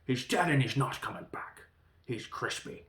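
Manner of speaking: mock-blunt death announcement, mostly loud; hammed-up imitation of tactless bluntness; straight-up blunt. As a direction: deliver the bad news with no cushioning. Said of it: "it was just straight up".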